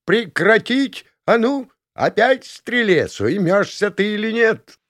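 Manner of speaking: very high, slow scolding holler; outraged warden's piercing yell; trumpeting dorm warden. A narrator's reading. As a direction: boom out an order, stretched and high. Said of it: "загремел трубный голос комендантши".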